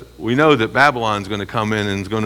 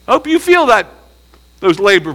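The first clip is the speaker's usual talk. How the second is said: much higher and louder, gloating; gloating taunt; 8 semitones higher, 5 dB louder than usual. Pretend. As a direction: rub it in. Gloating over the pain.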